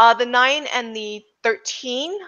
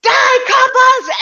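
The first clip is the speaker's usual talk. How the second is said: high, loud gangster war cry; yelling; 9 dB louder, slower than usual. Acted. Game master as gunmen attacking the police, high drawn-out yell. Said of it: "and they're yelling".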